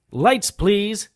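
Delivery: high call across the room. Acted. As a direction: call brightly to your helpers. Calling to helpers for lights.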